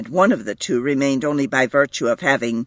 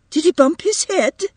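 high, worried mother's question; a mother fearing her baby is hurt; anxious; 13 semitones higher, 4 dB louder than usual. A narrator's reading. Mother to the room, high anxious question. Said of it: "returned anxiously".

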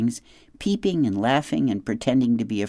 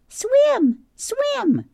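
much higher, tiny squeaky chirp; squeaky gosling; 4 dB louder than usual. A narrator's reading. Gosling Bright Bill excited about swimming day, tiny high squeak. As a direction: peep it out like an excited baby bird.

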